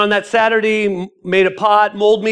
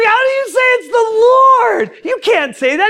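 high, loud disbelieving outcry; incredulous protest; 5 dB louder than usual. Retold or imitated. Acted incredulous protest to pastor.

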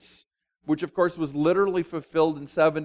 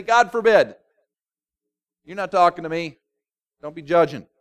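indignant leaders; 6 dB louder than usual. Acted. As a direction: snap back as the arrogant leaders. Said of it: "They just say".

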